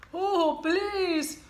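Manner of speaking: high, loud sniffly begging; sniffled plea. Acted. Cold-ridden wolf begging the pig for a tissue. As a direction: beg through a runny nose. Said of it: "sniffled Big Bad".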